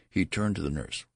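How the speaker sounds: ordinary delivery, nothing marked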